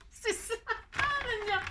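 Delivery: giggles into a squeaky high laugh. Real, not acted. Crafter laughs at her own taste, giggling. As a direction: laugh at yourself.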